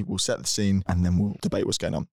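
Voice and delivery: ordinary talk, nothing marked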